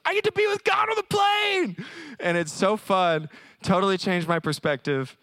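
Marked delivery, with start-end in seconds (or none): 0.1-1.9 high, loud, squealing delight; excited wife. Retold or imitated. Wife about to fly overseas, squealing with joy. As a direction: shout it like the best news ever. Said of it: "she's like".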